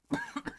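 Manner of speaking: hard cough; coughing fit. Real, not acted. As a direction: cough into the mic. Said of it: "pardon me".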